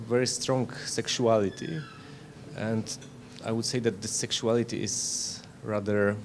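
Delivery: normal talking voice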